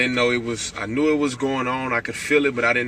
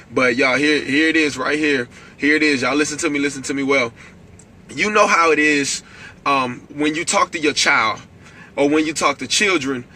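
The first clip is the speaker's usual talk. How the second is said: normal talking voice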